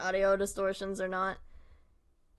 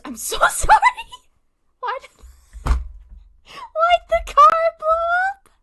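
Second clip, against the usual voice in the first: loud high squeal breaking into laughter; helpless laughter; 11 dB louder than usual. Real, not acted. Streamer startled by car distortion, laughing hysterically. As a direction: wail it through real, uncontrollable laughing.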